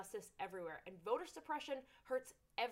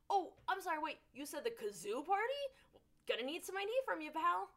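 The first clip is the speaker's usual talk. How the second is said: mock suspicious poll worker; 6 semitones higher, 3 dB louder than usual.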